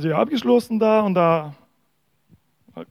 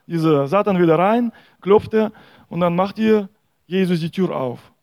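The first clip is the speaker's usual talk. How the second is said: normal talking voice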